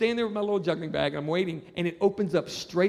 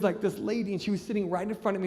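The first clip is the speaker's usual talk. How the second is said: usual speaking voice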